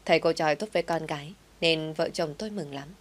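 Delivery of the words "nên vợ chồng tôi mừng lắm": plain reading voice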